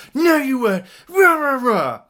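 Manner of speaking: high, hammed-up ranting; mock angry shouting. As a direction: parody a customer's tantrum. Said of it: "he starts shouting at me".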